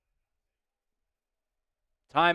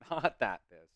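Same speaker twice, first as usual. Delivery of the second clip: laughing; 6 semitones lower, 12 dB quieter than usual. Real, not acted. Answering a viewer's joke, repeating it with a laugh. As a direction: chuckle it off. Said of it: "asking if I'm R. Kelly pissed".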